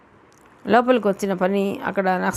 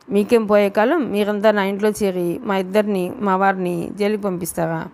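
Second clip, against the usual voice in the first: usual speaking voice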